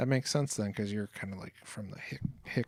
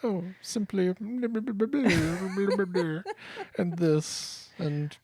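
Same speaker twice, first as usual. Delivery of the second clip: high, loud posh diva voice; Joan Collins impression; 8 semitones higher, 4 dB louder than usual. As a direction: ham up a haughty soap diva. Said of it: "my Joan Collins impression".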